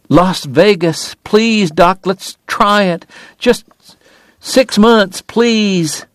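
high, coaxing plea; pleading. A narrator's reading. Woman wiping her eyes, begging Doc, pleading. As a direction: coax and beg, pitched high throughout.

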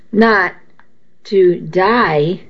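ordinary delivery, nothing marked

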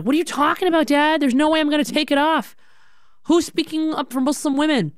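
high-pitched, impassioned retold protest; acted-out defiant teenage daughter; adamant. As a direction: argue it high, firing off rhetorical questions. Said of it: "I was very adamant".

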